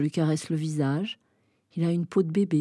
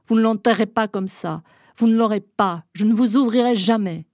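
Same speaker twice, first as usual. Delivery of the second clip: loud, higher refusal; defiant shout; 4 semitones higher, 6 dB louder than usual. Acted. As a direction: slam each refusal at them. Shouting at men through door.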